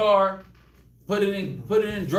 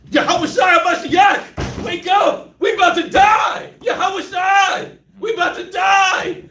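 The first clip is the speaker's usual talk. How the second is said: screaming, much higher and louder; panicked shout; 9 semitones higher, 9 dB louder, slower than usual. Retold or imitated. Disciples shouting at Jesus in the storm. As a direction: yell him awake. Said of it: "No, it".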